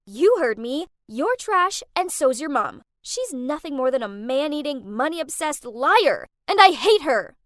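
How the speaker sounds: loud, raging scream; angry scream. Acted. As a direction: hurl each insult at her. Screaming row continues on phone.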